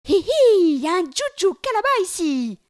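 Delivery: very high, loud giggling alarm; a squealing, teasing alarm cry; yelled. Acted. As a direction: shriek a warning to scare the buffalo off. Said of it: "hurle Zékéyé".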